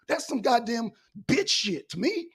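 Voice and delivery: ordinary delivery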